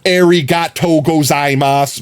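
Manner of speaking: "Arigato gozaimasu" with hard American r; anglicized Japanese thanks; American accent. Shown as a demonstration. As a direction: say it like a tourist. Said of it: "I did both of those terribly".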